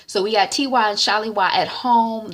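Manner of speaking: normal talking voice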